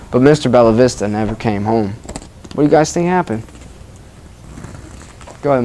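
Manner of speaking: usual speaking voice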